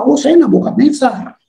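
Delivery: hammed-up refusal to be outdone; joking one-upmanship. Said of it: "Rasanya nggak mau kalah".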